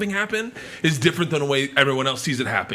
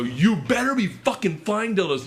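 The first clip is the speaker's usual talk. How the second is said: threatening heckle; 7 semitones higher than usual. Retold or imitated. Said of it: "I go".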